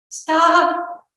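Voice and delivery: high, loud cry of stop; alarmed cry. A narrator's reading. Mother stumbling toward her littlest boy, alarmed cry. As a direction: cry out to halt him.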